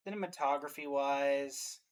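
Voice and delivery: ordinary delivery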